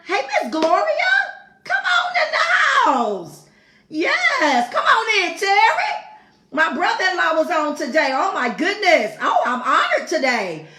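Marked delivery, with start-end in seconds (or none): 0.1-6.0 high, loud greeting of arrivals; excited shout-out. Real, not acted. Host greets viewers by name, high and loud. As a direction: call them in like family at the door.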